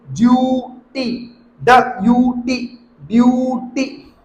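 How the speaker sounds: ड्यूटी and ब्यूटी slowly, यू held long before टी; long vowel demo. Shown as a demonstration. Teacher showing long यू, words drawn out. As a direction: stretch यू, set टी apart in ब्यूटी. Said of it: "जो long sound निकलता है".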